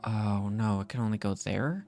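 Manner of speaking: normal talking voice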